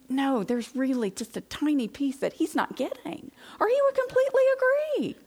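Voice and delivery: climbing to a very high, fast squeal of certainty; insistent inner voice. Retold or imitated. Wife convincing herself about her husband, rising high and fast. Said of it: "'cause I think".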